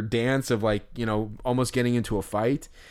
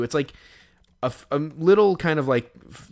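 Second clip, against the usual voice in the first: ordinary talk, nothing marked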